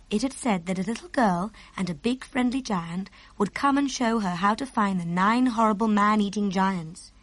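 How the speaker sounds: usual speaking voice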